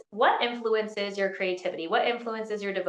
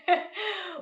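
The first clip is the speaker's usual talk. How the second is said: breaking into laughter; 11 semitones higher than usual. Real, not acted. Laughs at own mock wail.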